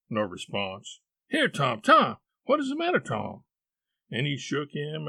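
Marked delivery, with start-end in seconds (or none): 1.3-3.4 quick, high, worried question; urgent call that drops at the end; alarmed calling. Acted. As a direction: try to rouse him, pitch jumping up. Boy alarmed, shaking groaning brother.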